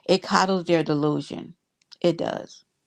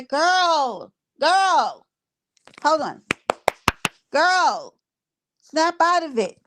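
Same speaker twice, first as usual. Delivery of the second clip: high scolding; 8 semitones higher, 3 dB louder, much slower than usual. Real, not acted. Telling her rival to snap out of it, loud high scolding.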